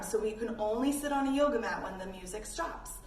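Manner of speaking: usual speaking voice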